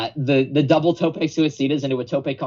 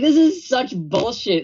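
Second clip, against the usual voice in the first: mock outrage; 10 semitones higher, 3 dB louder than usual. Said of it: "I was like".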